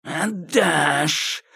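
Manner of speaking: hissing menace; hissed. A narrator's reading. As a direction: hiss the threat. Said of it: "прошипел Кощей". (прошипел Кощей)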